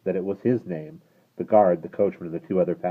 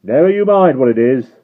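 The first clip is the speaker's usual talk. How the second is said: loud, high snapping retort; guard retorting at the rider; 8 semitones higher, 16 dB louder than usual. Acted. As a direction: shoot it back. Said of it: "the guard retorted".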